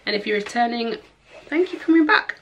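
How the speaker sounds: high, loud and bright presenter greeting; bubbly vlog intro. Acted. Vlogger greeting her returning viewers, bubbly and loud. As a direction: welcome the viewers with a big lift.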